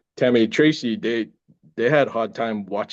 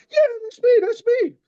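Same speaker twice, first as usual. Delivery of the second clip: excited shout. Retold or imitated. Jumping up excited at own scene.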